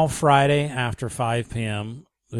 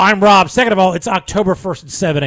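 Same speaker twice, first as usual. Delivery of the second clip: emphatic, much louder; loud correction; 7 semitones higher, 9 dB louder than usual. Real, not acted. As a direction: firmly set the record straight. Correcting the listener's wrong name.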